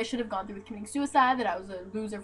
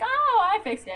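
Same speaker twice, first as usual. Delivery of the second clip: high, sing-song mimicry; mock cheery mom; 11 semitones higher than usual. Ex's mom claiming it is sorted, mocking high voice. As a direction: pitch it way up, mockingly breezy.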